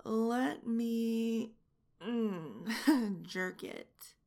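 usual speaking voice

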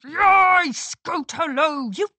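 loud high cheering. A narrator's reading. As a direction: cheer for the hero. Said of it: "erupted in cheers".

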